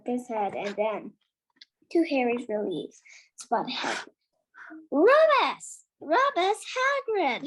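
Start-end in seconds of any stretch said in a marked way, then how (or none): 4.9-7.5 calling out a name with glad surprise; light, pleased hello to an old customer; delighted greeting. Acted. Ollivander happily greeting Hagrid, delighted.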